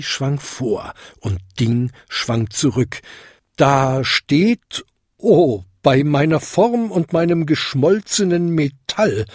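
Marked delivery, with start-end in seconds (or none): 3.6-9.2 lightly voiced bell, rising into alarm; hollow ringing. A narrator's reading. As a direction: ring it out, breaking into an exclamation. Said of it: "Ding läutete hohl". (hohl läutende Glocke)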